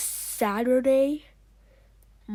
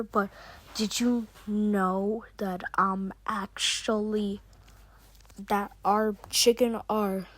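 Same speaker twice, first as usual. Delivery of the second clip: ordinary talk, nothing marked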